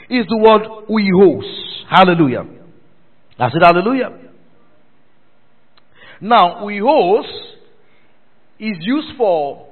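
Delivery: loud, high, slow pulpit teaching; emphatic preaching. Real, not acted. Preacher teaching congregation the Greek word, forceful slow preaching. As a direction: spell it out forcefully for a full church.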